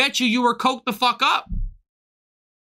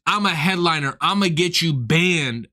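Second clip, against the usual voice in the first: imitating the headliner; 6 semitones lower than usual. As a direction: mimic a self-important tough guy, pitched down. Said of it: "Bro goes".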